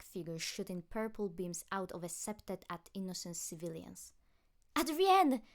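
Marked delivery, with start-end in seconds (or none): 4.8-5.4 high, loud call through the bathroom door. A narrator's reading. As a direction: call to him from the other side of a door. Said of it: "Marinette called".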